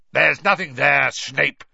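loud barked rebuke; old auror barking down at Snape. A narrator's reading. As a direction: bark it at him like an order. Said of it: "barked Moody".

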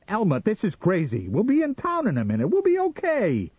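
very high, loud, desperate insistence; frantic shouting. A narrator's reading. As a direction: shout at her, climbing in panic. Sam frantic with his wife.

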